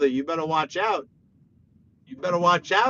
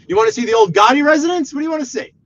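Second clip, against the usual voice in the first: higher, louder, fast pitch; playful excited offer; hyped tour guide; 9 semitones higher, 10 dB louder, much faster than usual. Pretend. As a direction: sell it like a tour.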